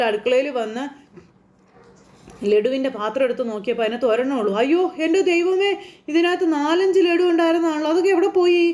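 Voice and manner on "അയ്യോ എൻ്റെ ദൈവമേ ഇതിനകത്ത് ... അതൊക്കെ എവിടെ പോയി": shocked grandmother. Retold or imitated. Grandmother finding laddus missing from the jar, alarmed exclamation. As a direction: gasp it out as if the sweets vanished. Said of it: "എന്നൊക്കെ പറയുക തള്ള".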